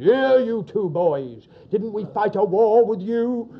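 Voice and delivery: slow, loud, lightly voiced old grumbler; a hint of a blunt old soldier; loud, slow mock gruff challenge; gruff old neighbour. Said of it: "he lumbered and said".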